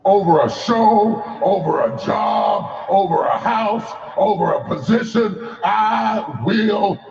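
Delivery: rhythmic call, slow on the refrain; preaching refrain. Acted. Preacher leading a rising refrain, measured. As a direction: preach each line, then land the refrain slowly.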